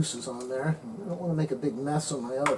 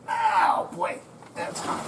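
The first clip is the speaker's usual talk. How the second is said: pained whisper; 4 semitones higher, slower than usual. Real, not acted. Burned by hot pan.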